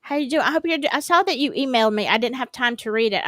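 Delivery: ordinary talk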